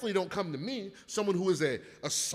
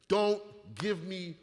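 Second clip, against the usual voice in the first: ordinary talk, nothing marked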